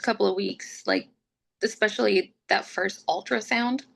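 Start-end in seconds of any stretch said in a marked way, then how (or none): none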